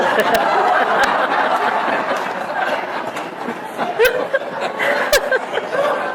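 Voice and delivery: long laugh from the audience; the room breaks up laughing; crowd laughter. Real, not acted.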